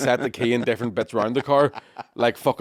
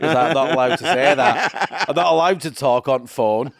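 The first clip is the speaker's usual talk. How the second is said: put-on drawn-out, louder English cabbie accent; mock English accent; 2 semitones higher, 5 dB louder, slower than usual. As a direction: stretch it out as a hammed-up English cabbie. Same mock English driver voice.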